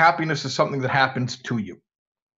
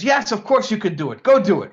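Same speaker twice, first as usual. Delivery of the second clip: raised, emphatic doctor's voice; insistent urging; 6 semitones higher, 5 dB louder than usual. Acted. Doctor urging a reluctant patient to the circus, insistent. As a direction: push the patient briskly to go.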